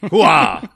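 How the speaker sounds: barked army hooah; loud gung-ho soldier. Retold or imitated. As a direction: shout it like a drill sergeant. Calling on Brian's catchphrase.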